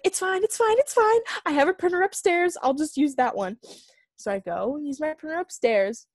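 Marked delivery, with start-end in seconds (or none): 0.0-3.6 much higher, louder, strained cheerful reassurance; mock-calm, near-panicky self-reassurance; forced it's fine. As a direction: insist it's fine while clearly not fine. Forcing calm about the printer.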